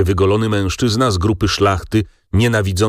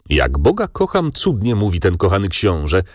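ordinary delivery, nothing marked

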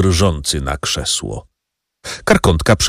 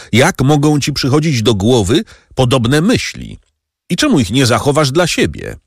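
higher-pitched, mildly reproachful protest; indignant exclamation; 7 semitones higher than usual. A narrator's reading. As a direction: exclaim in reproach without really shouting. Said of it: "zawołał Kadrus". (oburzony okrzyk, wyższym głosem)

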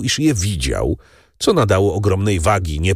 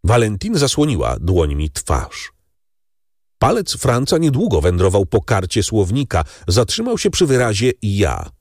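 ordinary delivery, nothing marked

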